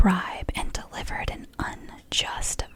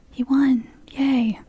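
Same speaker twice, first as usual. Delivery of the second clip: out-loud, louder ironic little cheer; half-hearted ironic celebration; mock cheer; 3 semitones higher, 7 dB louder, slower than usual. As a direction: break the whisper for a wry yay. Mock cheer at grim fact.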